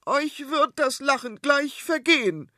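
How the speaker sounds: high, loud cry of a fretful queen; queen calling to her family, high and loud. Acted. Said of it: "rief sie den dreien entgegen".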